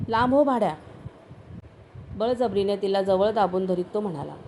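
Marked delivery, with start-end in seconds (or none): 0.1-0.7 higher, louder angry retort. Acted. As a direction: push him away with fury in the voice. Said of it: "ती रागानं बोलली".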